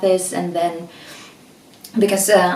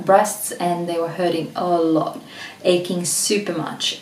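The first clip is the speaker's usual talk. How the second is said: normal talking voice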